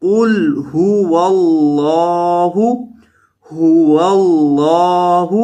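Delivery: very slow, drawn-out melodic tilawat; Quran recitation. Shown as a demonstration. Teacher reciting the sura slowly for students. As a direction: stretch every syllable in a chanted recitation. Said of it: "تلاوت کے انداز میں".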